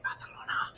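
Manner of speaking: "Barcelona" in a soft whisper, c as th; whispered lisped Barcelona. Retold or imitated. Insisting that's how they say it, whispered lisped Barcelona. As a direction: breathe out "Barcelona" with a Spanish lisp. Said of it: "That's how they say it".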